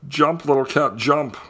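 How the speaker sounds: fishermen calling up to the cat; yelling. A narrator's reading. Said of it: "Two fishermen held at their net and yelled".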